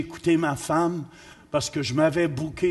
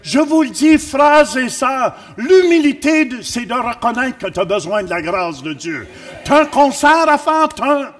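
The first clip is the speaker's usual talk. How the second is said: loud, high, stretched-out proclamation; full-force preaching; 7 semitones higher, 10 dB louder than usual. Real, not acted. Preacher telling the congregation God gives grace, full-force preaching. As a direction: shout it out to the congregation.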